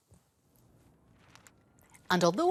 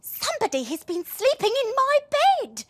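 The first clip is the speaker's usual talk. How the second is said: high indignant mother; mum voice; 6 dB louder than usual. A narrator's reading. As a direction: protective fussing mum. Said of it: "said the mummy person".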